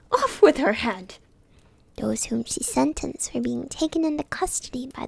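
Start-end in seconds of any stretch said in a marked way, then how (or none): 0.1-1.1 shouting queen. A narrator's reading.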